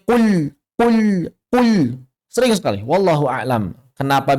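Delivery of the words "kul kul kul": "kul" with a thick, heavy l, drawn out and higher; mispronounced kul. Shown as a demonstration. Quran teacher imitating a common mistake in "kul", thick-l pronunciation. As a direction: say "kul" with the l wrongly thickened and held. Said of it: "Banyak orang yang baca kul ini".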